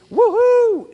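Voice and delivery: a high, loud woohoo, held at the top; gleeful whoop. Retold or imitated. Preacher picturing life suspending you, whooping high. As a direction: whoop as if hanging mid-swing. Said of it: "Sometimes it's".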